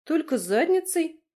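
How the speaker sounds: high, loud indignant question. A narrator's reading. Woman objecting to her lover's crude compliment, indignant. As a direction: jump up in pitch, affronted. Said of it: "возмутилась я".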